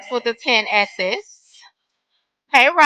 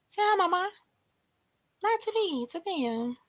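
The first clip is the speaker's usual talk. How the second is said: higher and softer, coaxing the dog; tender pet talk, softly raised; 5 semitones higher, 13 dB quieter, faster than usual. Real, not acted. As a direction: coo gently at the pet to say hi. Coaxing pet to greet viewers.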